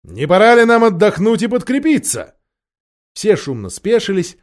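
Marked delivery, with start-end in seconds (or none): 0.0-2.4 king's hearty shout to his friends; raised-voice royal hail; calling out. A narrator's reading. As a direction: call out loudly, pitch lifted. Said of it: "Король крикнул".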